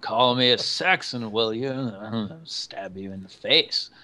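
mumbled rambling with words popping out; muttering madman. Acted. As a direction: mutter to yourself like a crazed hermit. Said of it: "washing himself and muttering".

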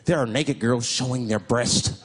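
low, grave warning; hammed-up ominous stranger; mock warning.

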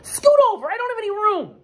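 very high and loud, complaining; grumbling Duck. Acted. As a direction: squawk it like a squashed duck. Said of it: "said Duck".

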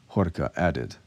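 ordinary delivery, nothing marked

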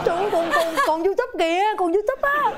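can't believe he's still filming; laughing disbelief. Real, not acted. (cười không tin nổi, giọng cao)

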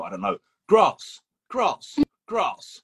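repeating grass with swooping high pitch; over-careful dictionary grass, rising high; mock dictionary drilling. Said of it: "you kind of go".

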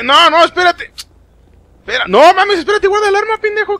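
high-pitched, frantic protest; mock panic. Player telling game character to put the gun away, panicked. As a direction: plead in a high squeal for them to stop. Pleading as a weapon is drawn.

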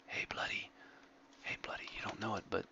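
whispered, like creeping up behind someone; breathy secret hush, so he won't notice; sneaky whisper. Pretend. Whispering to an AFK friend while sneaking up on him.

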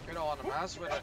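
Scottish accent. Shown as a demonstration. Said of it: "replicate the Irish accent".

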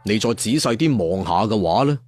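plain reading voice

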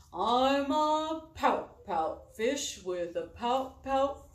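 slow, glum fish refrain, stretched out; sulky pout fish. Acted. Fish replying to the clam, slow and glum. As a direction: drag it out like a sulking fish.

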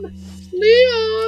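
high sung call. Real, not acted. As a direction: call out his name high. Calling character.

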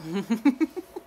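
bright burst of real laughter; laughing. Real, not acted. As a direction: let a real laugh escape. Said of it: "There I go laughing again".

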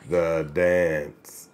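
extremely slow, low, every word stretched; low, drawn-out slow-motion voice. Acted. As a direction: drag each word out as if played at reduced speed. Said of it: "Read the joke in slow motion".

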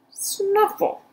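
high snuffling pig sound; pig snuffle. Acted. Mercy the pig snuffling at the window, high. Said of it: "said Mercy".

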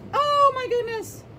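big high gasp of surprise; surprised. Acted. Teacher showing the class the back cover, high surprised exclaim. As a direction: gush with delight at the cover.

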